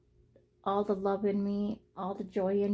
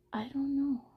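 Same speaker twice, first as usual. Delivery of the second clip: whispered uncertainty; 4 semitones higher, 4 dB quieter than usual. Real, not acted. Unsure, voice drops.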